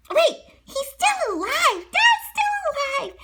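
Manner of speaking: high, slow overjoyed cry; joyful relief. Acted. Pinocchio reading that his dad lives, high overjoyed cry. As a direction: burst out with hope, high and slow.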